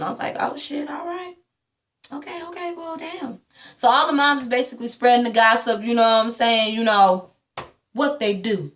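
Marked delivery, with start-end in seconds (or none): 0.4-3.4 high, soft stunned "oh shit"; hushed shock. Retold or imitated. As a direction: breathe it out, taken aback. Stunned reaction to Bobby dropped.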